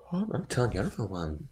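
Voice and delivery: talking through a yawn. Real, not acted. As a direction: yawn while saying it. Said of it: "why I'm yawning so much".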